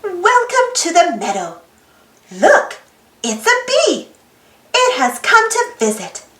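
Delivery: ordinary delivery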